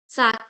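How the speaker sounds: sac as "sak", final C sounded; French sac. Shown as a demonstration. Teacher showing last letter pronounced, sac ending in K. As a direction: say sac loudly with a hard final K. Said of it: "we will pronounce the last letter".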